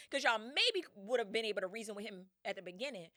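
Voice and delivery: ordinary talk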